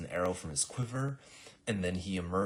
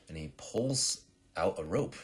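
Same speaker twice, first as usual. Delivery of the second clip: plain reading voice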